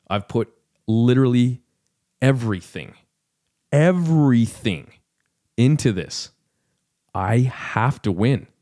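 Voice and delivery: slow, drawn-out emphasis peaking on the second "everything"; emphatic self-pressure. Acted. Fighter telling himself he has to win, with mounting emphasis. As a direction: punch each phrase as if all is staked on it.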